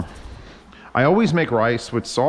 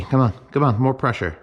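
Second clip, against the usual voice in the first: ordinary delivery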